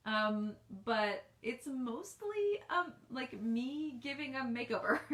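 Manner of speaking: ordinary talk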